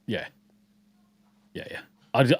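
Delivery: ordinary talk, nothing marked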